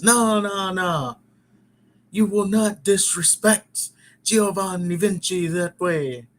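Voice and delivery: badly faked European accent. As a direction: put on a hammy European accent, indignant. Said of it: "that's a bad accent".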